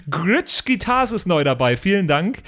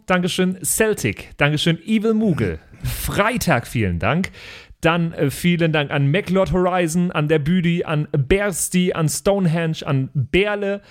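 usual speaking voice

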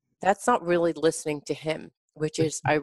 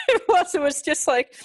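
laughing; 14 semitones higher than usual. Real, not acted. Laughing while recounting the quick apology.

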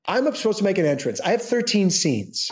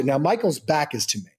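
usual speaking voice

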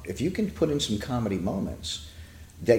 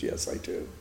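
put-on whispered child reply; 8 dB quieter than usual. Frightened boy answers officer.

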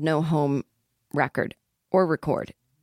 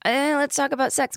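higher put-on silly voice; mock awkward opener; 8 semitones higher than usual. Imagined awkward opening to acquaintance.

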